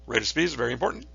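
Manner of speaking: ordinary talk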